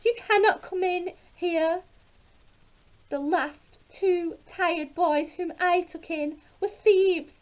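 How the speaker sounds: high-pitched wife's refusal; ogre's wife refusing. A narrator's reading. Ogre's wife turns Jack away at the door, high and firm. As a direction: keep her voice up and insistent. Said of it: "she cried".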